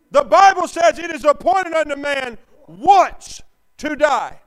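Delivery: loud, high preached verse; full-force pulpit shout; shouted scripture. Acted. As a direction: thunder the verse at the church.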